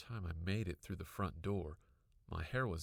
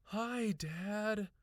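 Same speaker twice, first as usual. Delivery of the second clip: breathlessly; 13 semitones higher, 5 dB louder than usual. A narrator's reading. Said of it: "I cried breathlessly".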